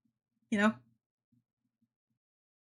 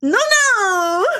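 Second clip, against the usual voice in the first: high, louder delighted cry; imitating Parasi again; 11 semitones higher, 5 dB louder than usual. Imitating Parasi seeing him in chat, high delighted cry. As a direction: ham up her happy shriek. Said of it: "That's how she does it".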